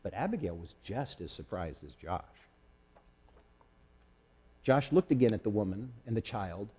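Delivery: ordinary delivery, nothing marked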